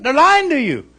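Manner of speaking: ordinary talk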